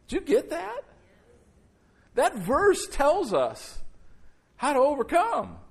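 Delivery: rising preacher's fervor; fired-up preaching. Acted. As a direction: press the point home to the crowd. Preacher urging the congregation.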